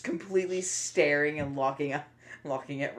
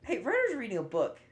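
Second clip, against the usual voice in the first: outraged realization; 12 semitones higher, 7 dB louder than usual. Retold or imitated. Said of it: "you put the pieces together".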